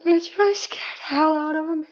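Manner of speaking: high, tense, frightened; pitched far up in real fear; scared. Real, not acted. Hospital patient scared by staff, high and frightened.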